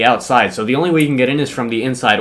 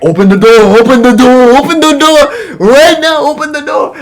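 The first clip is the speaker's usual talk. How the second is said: mock Haitian accent, yelled much higher and louder; angry Haitian accent; 12 semitones higher, 7 dB louder than usual. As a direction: imitate the substitute's Haitian accent, rising to a frantic shout. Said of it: "'cause of his accent".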